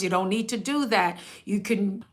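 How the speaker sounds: ordinary delivery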